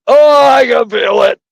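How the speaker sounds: high, loud strained groan; exaggerated pained exclamation. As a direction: groan it out loudly on getting up. Re-enacts getting up with groans.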